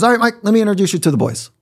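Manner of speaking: high, quick coach voice; imitated coach. Head coach bringing newcomer out to meet team, brisk imitation. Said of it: "He goes".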